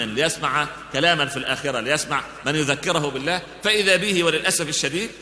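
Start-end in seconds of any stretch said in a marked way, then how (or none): none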